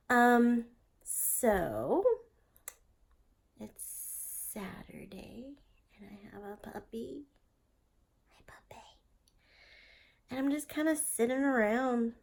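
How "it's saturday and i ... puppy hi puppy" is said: whispering. Real, not acted.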